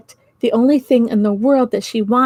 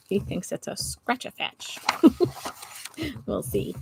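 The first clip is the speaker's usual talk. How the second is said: soft whispered aside; 9 dB quieter than usual. Real, not acted. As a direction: whisper it to the listeners. Aside to listeners.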